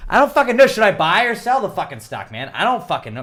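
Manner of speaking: exasperated loud outburst; frustrated yell. Retold or imitated. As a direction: blurt it out, fed up. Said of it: "and you're like".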